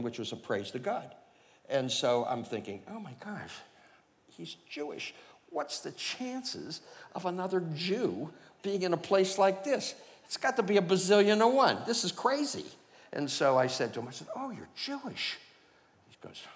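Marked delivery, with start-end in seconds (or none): 2.9-12.7 higher and higher, breathless astonishment; amazed inner thought. Retold or imitated. 14.3-15.4 much higher, delighted surprise; surprised discovery. Retold or imitated.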